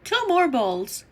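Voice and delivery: usual speaking voice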